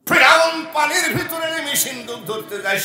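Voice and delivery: high, loud dramatic announcement; full-force preaching. Acted. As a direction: proclaim the sudden arrival at the top of your voice. (উঁচু স্বরে নাটকীয় ওয়াজ)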